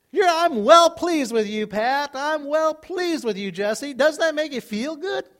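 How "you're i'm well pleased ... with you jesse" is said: enthusiastic praise. Acted. Praising people by name.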